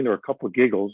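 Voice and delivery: ordinary talk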